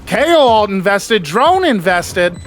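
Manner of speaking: fast, high play-by-play call; esports caster. Real, not acted. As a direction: build hype through the clutch. Live play-by-play of a clutch.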